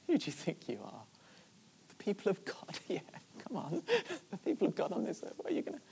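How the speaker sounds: acting out soft, derisive chuckling; quiet mock disbelief between little laughs; scoffing. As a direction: belittle them under a light laugh. Revoices scoffing doubters, quietly laughing.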